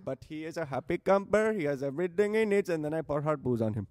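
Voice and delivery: put-on Indian accent. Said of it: "Needs to be like a bad Indian accent".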